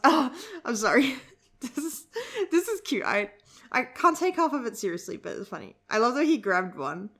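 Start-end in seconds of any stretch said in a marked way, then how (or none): 0.0-3.0 laughing. Real, not acted. Laughing at a cute scene.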